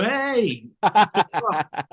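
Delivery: high, drawn-out playful "hey"; hammed-up hello to a star; mock cheery greeting. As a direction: call out a jokey, high-pitched greeting. Imagined greeting to get Arnold's attention.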